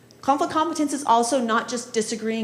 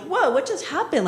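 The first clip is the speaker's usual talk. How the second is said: a higher, startled "whoa"; surprised mom; 7 semitones higher than usual. Retold or imitated. Mom watching her kids storm out: startled, higher. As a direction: mom caught off guard, voice jumping up. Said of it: "So mom's like".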